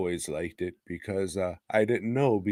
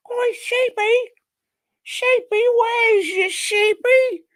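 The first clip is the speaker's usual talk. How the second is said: falsetto calling; 4 dB louder than usual. Retold or imitated. A brother calling for his pet sheep in a high sing-song falsetto. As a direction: coo out for the lost pet in falsetto.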